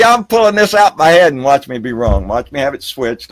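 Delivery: normal talking voice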